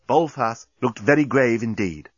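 usual speaking voice

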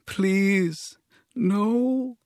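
very high, small frightened voice; whispered plea. A narrator's reading. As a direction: beg like someone scared and whimpering. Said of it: "she whispered".

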